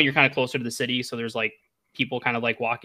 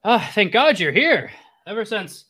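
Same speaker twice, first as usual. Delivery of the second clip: put-on high, swooping old man voice; high-pitched, dramatic old sage; relieved old hermit; 8 semitones higher, 4 dB louder than usual. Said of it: "the old hermit".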